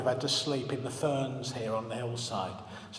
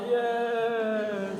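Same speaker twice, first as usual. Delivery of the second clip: higher, firm dramatic yes; hammed-up heroic yes; war movie insistence; 6 semitones higher than usual. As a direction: insist like a martyr in a film. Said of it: "it was like a war movie".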